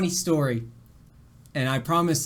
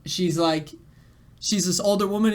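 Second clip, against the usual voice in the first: ordinary talk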